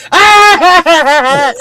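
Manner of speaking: put-on high, loud girlish shriek; imitated horrified scream; mock scream. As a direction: shriek in hammed-up horror.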